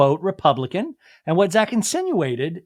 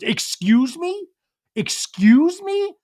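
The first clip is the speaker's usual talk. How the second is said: drawn-out, high, rising mock indignation; imitating someone deeply offended; affronted disbelief; 12 semitones higher, much slower than usual. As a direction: stretch it out, pitching higher the second time. Acting Jesse's affronted reply to Luke.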